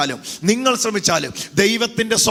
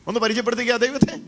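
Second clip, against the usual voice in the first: ordinary delivery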